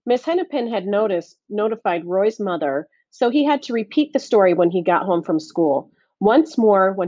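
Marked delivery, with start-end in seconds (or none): none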